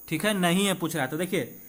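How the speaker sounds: ordinary talk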